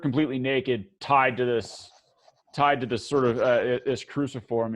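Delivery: ordinary talk, nothing marked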